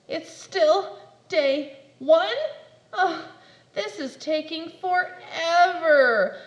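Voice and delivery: hammed-up sobbing; mock crying. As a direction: wail at the wait.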